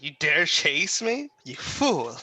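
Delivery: slow, mocking villain sneer; villain taunt. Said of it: "I'm gonna laugh at him like".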